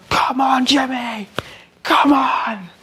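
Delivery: high, strained mock shout; client freaking out. Client begging the tattooist while hitting himself, strained high shouting. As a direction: imitate a desperate, drawn-out yell. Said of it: "smashing it into his own face".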